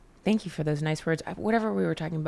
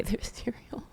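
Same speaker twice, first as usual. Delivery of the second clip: whispering; 3 semitones higher, 7 dB quieter, slower than usual. Acted. Joking boycott of cereal brand.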